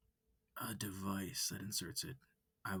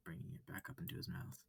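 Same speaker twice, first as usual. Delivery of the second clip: plain reading voice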